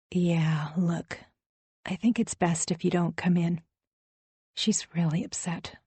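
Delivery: soft, hushed, half-whispered; soft conspiratorial voice. A narrator's reading. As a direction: lean in and keep it secret. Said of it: "In her softest, almost conspiratorial voice".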